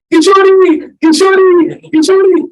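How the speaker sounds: high, loud repeated calling of a name; desperate calling. Retold or imitated. Radharani imitates the man calling, high and loud. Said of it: "chanting my name with such desperation".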